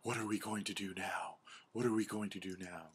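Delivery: hushed, anxious repetition; upset animals. Acted. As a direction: fret quietly, half-whispered. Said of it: "all the animals are really upset".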